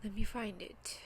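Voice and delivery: whispered, fighting back tears; tearful whisper. Real, not acted. Whispering to viewers while trying to stop crying. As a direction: whisper it while wiping your eyes. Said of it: "my eyes are sweating".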